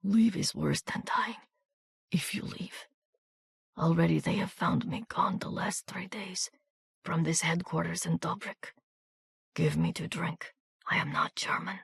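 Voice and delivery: a wounded man whispering urgently; wounded soldier whispering to Miranda in a soft foreign accent; soft whispered plea. A narrator's reading. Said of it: "with a strange, soft accent".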